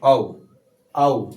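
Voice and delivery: औ as a slow demo; clear teaching demo. Shown as a demonstration. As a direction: drill the vowel औ out loud. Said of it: "दो बार मात्रा गया".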